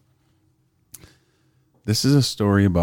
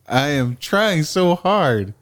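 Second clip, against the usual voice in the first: slow, higher put-on Chinese accent; 8 semitones higher, slower than usual. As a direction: drawl it in a mock Chinese accent.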